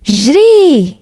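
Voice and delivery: urgent narrated yell, one drawn-out word; mild shout of warning; shouted command. A narrator's reading. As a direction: shout it like a father urging his son to run. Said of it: "الأب وقف قدامهم وصرخ".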